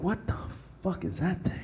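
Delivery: very soft, stunned mutter; hushed disbelief. Acted. Pilgrim sees the wolf, hushed disbelief. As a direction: say it under your breath, baffled.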